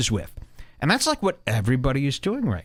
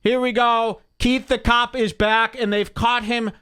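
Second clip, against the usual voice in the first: mock breaking-news bulletin; 7 semitones higher than usual. Host interrupting with a scoop, high mock newsflash. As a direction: announce it like an urgent scoop, pitched way up.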